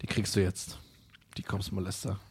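dropped way down to a murmur; quiet aside. Real, not acted. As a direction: mutter it low under the breath. Quiet aside before playing track.

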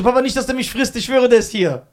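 insist, scared, to dad; child swearing to dad the monster is here; frightened child. Retold or imitated.

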